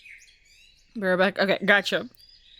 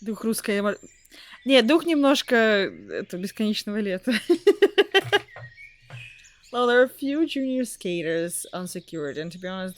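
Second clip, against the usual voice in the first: usual speaking voice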